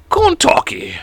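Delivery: high, whispery mock announcement; silly announcement. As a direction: say the state in a tiny, airy high voice. Silly announcement of next state.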